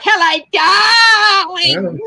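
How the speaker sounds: high, slow, drawn-out gushing "Hello, darling"; Jeannie Little voice. Retold or imitated. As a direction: stretch every syllable like a flamboyant grande dame. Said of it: "her voice was like".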